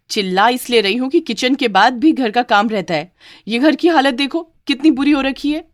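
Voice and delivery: angry scolding. Acted. Bride scolds maid.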